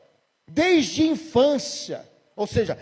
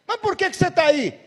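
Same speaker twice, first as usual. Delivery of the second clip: pressing question; 9 semitones higher, 4 dB louder than usual. Retold or imitated. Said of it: "o pastor perguntou".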